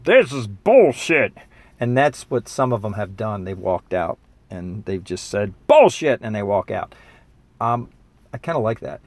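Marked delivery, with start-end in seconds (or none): 0.0-1.3 mock dismissive scoff, drawn out slow. Senior waving off his talk, scoffing. 5.7-6.2 blurted high and loud; mock outburst. Senior storming out, loud scornful blurt.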